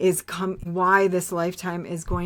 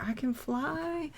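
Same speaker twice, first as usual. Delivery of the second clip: high, soft, airy and slow, lightly voiced; giddy relief; 8 semitones higher, 8 dB quieter, slower than usual. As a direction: float the words like you're weightless. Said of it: "if I was like".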